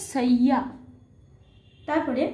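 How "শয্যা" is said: শয্যা as "shay-ya", y sounded in place of j; Sanskrit pronunciation. Shown as a demonstration. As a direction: say শয্যা with a clear double y. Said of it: "তাহলে উচ্চারণ কী হবে".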